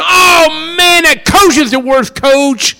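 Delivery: high, loud griping about the coach; angry fans. Retold or imitated. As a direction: complain loudly like a disgusted fan. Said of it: "your friends go".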